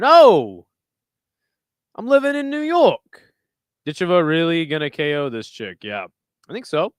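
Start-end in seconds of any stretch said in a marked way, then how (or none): 0.0-3.3 loud, pitched-up comic New Yorker; Joey Tribbiani. Acted. Streamer playing along with chat's comment, Joey Tribbiani impression. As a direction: ham up a sitcom New York guy. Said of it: "nice Joey Tribbiani impression".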